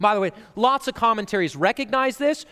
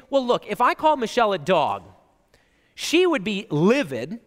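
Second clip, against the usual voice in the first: usual speaking voice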